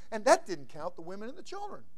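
ordinary talk, nothing marked

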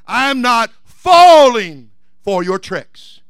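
slow and loud, "falling" drawn out high; defiant declaration. Acted. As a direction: punch and stretch "falling" in defiance. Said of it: "And you tell him".

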